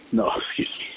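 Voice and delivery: whispered apology through a laugh; breathy laugh, then a soft excuse me. Real, not acted. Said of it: "laughs, whispered aside".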